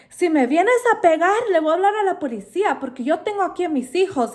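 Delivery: high, rushed mock threat in Spanish; imitating threatening neighbor. Neighbor threatens police, voiced.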